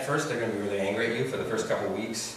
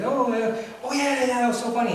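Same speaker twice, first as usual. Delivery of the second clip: high, delighted agreement; happy reminiscing; 13 semitones higher, 3 dB louder than usual. Acted. Friend answering the surprise call, happy and amused. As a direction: play along cheerfully on the phone. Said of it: "you're still happy about the conversation".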